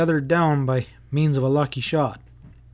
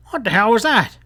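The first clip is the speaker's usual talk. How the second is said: startled growl, higher and louder; 9 semitones higher, 5 dB louder than usual. A narrator's reading. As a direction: bark it out in alarm. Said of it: "Rufe brought out in a growl".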